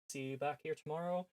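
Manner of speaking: ordinary talk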